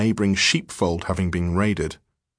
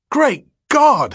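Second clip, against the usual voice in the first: high, loud horrified outburst; loud, high-pitched cry of alarm; shocked exclamation; 7 dB louder than usual. Acted. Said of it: "he exclaimed".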